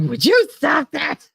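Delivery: high, drawn-out exasperated outburst; mock exasperation. Friend telling her to stop singing during the game, exasperated. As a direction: imitate a fed-up friend, pitched well up.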